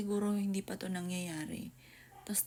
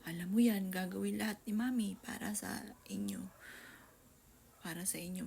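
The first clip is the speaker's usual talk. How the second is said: ordinary talk, nothing marked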